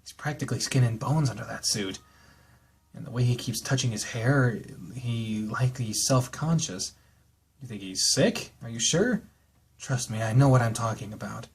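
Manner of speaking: calm, even read-aloud narration; story narration. Acted. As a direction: read the novel text evenly, like an audiobook. Reads visual novel narration.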